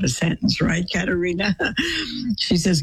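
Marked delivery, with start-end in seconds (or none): 1.6-2.3 a quick amused laugh; short laugh. Real, not acted. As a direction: laugh lightly at the joke. Teasing listener about yawning.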